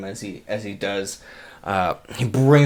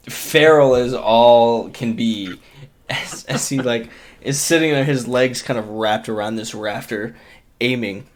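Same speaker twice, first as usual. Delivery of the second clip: usual speaking voice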